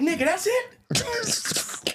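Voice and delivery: very high, slow drawn-out disbelief; incredulous. Retold or imitated. Hearing he was only dehydrated, drawn-out disbelief. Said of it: "I was like".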